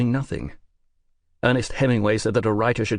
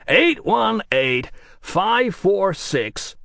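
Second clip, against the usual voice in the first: American producer voice; 9 semitones higher, 4 dB louder, much slower than usual. Acted. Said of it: "work a lot harder on that American accent".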